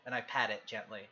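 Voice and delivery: ordinary delivery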